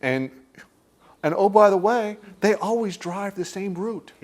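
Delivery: usual speaking voice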